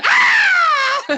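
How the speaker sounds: very loud, high-pitched scream; banshee scream. Retold or imitated. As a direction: scream to scare someone out of their skin. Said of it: "screaming like a fucking banshee".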